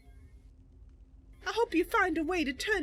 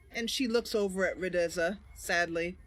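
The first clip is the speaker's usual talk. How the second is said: narrating stage directions; 12 semitones lower than usual. A narrator's reading. Reads stage directions aside.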